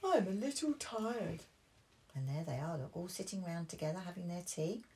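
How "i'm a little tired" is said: higher, sheepish admission; lightly voiced sleepy dad; tired Daddy Pig. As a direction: own up to being tired. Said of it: "said Daddy Pig".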